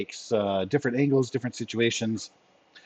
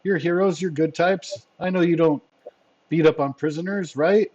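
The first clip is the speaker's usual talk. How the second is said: higher, anxious begging; pleading henchman; 6 semitones higher than usual. Acted. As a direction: appeal nervously to your captors' good nature. Henchman pleads with threatening heroes.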